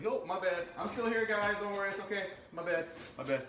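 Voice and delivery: playful squeaky falsetto apology; high-pitched reassurance. Pretend. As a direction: pipe up high to soothe the viewers. Playfully reassures viewers he is back.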